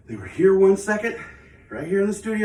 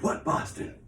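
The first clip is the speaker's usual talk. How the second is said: whispered question; 7 dB quieter than usual. Retold or imitated. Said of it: "And he's like".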